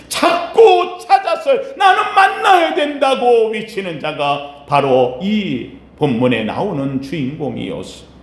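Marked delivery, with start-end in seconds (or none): 0.1-3.5 fervent crying out. Acted. Preacher voicing the beggar desperate to meet Jesus, fervent cry. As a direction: cry it out high and stretched, then ease down.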